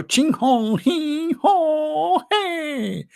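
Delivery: mock Chinese name.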